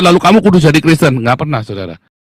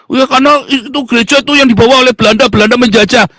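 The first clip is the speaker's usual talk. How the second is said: mocking imitation; 5 semitones higher than usual. Mimics an objector, then rebuts.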